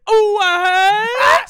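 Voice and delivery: sudden loud high surprised "Oh, what?"; a shocked yelp, loud and high; startled oh what. Retold or imitated. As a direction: jump out of the blank stare, caught off guard. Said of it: "And resting bitch face gone".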